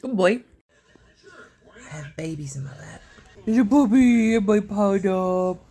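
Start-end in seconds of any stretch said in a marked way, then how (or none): 1.8-3.0 hushed whisper; whisper, babies sleeping on you; soft whispered aside. Real, not acted. Whispering, puppies asleep in lap.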